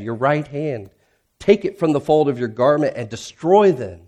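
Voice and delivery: loud, pleading scripture reading with rising cries; impassioned psalm reading. Acted. Pastor reads Psalm 13's lament aloud, loud and pleading. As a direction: cry out the lament to God.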